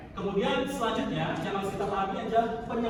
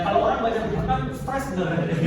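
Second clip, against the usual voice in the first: ordinary talk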